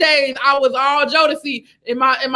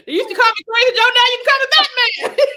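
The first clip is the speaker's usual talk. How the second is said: high, fast, laughing film-line impression; excited mock movie-character voice; hammed-up movie quote; 12 semitones higher than usual. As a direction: shout out a famous line, pitched way up, laughing. Acted movie quote through laughter.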